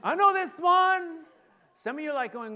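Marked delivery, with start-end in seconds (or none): 0.0-1.3 much higher and louder, slow and proud; the keen Sunday school kid blurting out; eager know-it-all. Retold or imitated. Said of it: "you can tell who's been to Sunday school".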